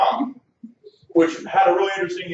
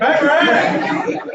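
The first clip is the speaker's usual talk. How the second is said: slow, loud, high cue shout; host answering the crowd with the cue word; 11 semitones higher, 7 dB louder, much slower than usual. Real, not acted. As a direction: drag out the cue with a laugh.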